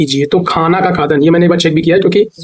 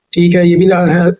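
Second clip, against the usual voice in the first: normal talking voice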